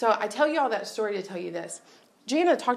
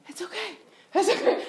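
brother reassuring; 11 semitones higher than usual. Retold or imitated. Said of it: "my brother's like".

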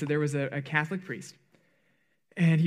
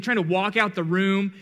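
ordinary delivery

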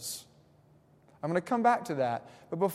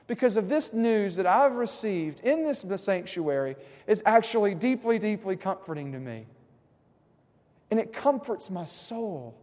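higher, louder pulpit climax; impassioned preaching; 5 semitones higher, 9 dB louder than usual. Acted. Pastor preaching comfort to his church at full force. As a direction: lift them to the promise with rising fire.

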